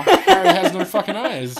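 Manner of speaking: half-laughing exclamation of disbelief; incredulous outburst. Real, not acted. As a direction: blurt it out in amazed disbelief. Incredulous outburst over absurd story.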